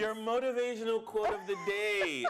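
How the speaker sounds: bright, high announcer intro. Acted. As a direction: present the quote like a radio host. Introduces quote of the day.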